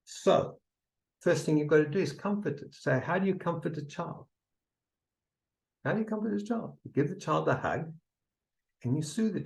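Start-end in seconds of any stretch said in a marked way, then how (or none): none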